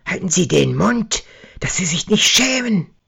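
put-on an enraged boss bellowing at the drunk; furious yell. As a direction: shut the drunk up. Manager yells at drunks.

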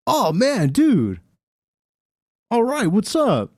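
high, peppy preppy voice; ultra-preppy guy. Retold or imitated. Drive-thru worker greeting a customer, drawn-out and peppy. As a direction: imitate an over-friendly preppy dude. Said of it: "the ultra preppy guy".

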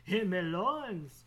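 slow, loud, drawn-out and hammed-up; loud theatrical repeat; mock dramatic loins. Shown as a demonstration. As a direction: belt it out like a joke melodrama. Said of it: "laughs then repeats louder".

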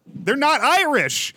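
loud, high, drawn-out protest; outraged shout. Real, not acted. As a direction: shout it slowly in comic outrage. Comic outrage at co-host's remark.

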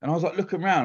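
ordinary delivery

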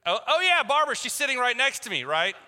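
high, loud mocking reply; sarcastic. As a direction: toss it back in high-pitched sarcasm.

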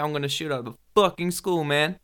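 American accent. Shown as a demonstration. Mate outdoing his friend's American attempt, loud American accent. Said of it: "a bit too much practice".

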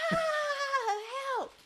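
high, mock panicked cry for help; pretend scream for help. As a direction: yell for help in fake panic. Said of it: "Now go".

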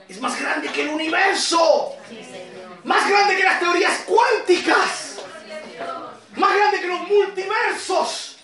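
high, emphatic preaching in awe of God; prédica exaltada. Real, not acted. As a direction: proclaim God's greatness with rising fervour. Predica con fuerza. (predicación exaltada, con voz alta y enfática)

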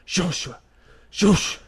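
mock them saying "Joshua" like someone just breathing it out, rising in pitch; breathy mangled Joshua. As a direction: people straining to call out the name "Joshua". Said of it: "só como alguém respirando".

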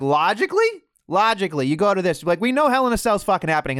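ordinary talk

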